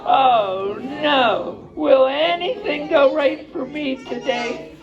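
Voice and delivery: high, slow, hammed-up wail; woeful lament. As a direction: drag out the whine of a little bear. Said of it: "he had to say".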